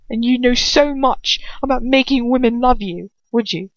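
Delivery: mocking. A narrator's reading.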